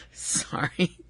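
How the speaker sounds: whispered apology through a fit of giggles; can't stop giggling while apologising; laughing apology. Real, not acted. Vlogger apologising to viewers while giggling, laughing. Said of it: "I keep laughing".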